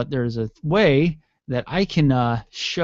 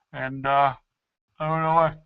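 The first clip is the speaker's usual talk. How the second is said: ordinary talk, nothing marked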